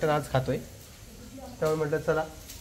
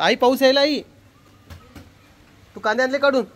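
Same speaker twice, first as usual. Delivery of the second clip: raised, higher call across the house; 7 semitones higher, 3 dB louder than usual. Real, not acted. Son telling mother rain has come, calling out. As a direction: call out loudly to your mother.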